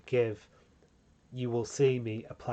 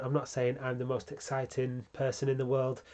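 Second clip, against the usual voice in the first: normal talking voice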